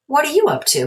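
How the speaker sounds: a mother asking her son; curious mom. Acted. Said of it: "his mom asked".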